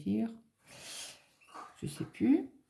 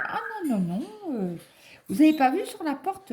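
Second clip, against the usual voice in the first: high, sharp receptionist voice; imitating a snappy receptionist, pitched up; mock receptionist retort; 9 semitones higher than usual. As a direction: play the brusque receptionist, pitched up.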